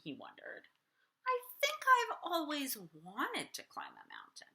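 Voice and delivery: put-on lively read-aloud for kids; storybook reading. A narrator's reading. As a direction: read it like bedtime story time. Reading picture book aloud.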